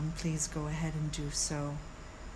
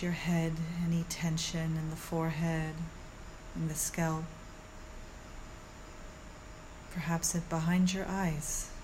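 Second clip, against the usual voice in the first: soothing yoga teacher; 3 dB quieter than usual. Acted. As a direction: guide them calmly through the body. Leads a guided meditation.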